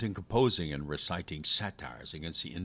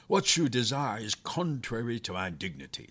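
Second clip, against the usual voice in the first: haughty captive, raised and louder; arrogant; 5 semitones higher, 6 dB louder than usual. A narrator's reading. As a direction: stand loftily on your dignity. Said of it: "with all the arrogance that a being of his size could express".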